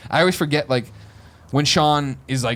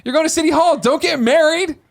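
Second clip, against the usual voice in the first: mock driver warning; 4 dB louder than usual. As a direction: squeak out the joke warning like the driver did. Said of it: "He's like".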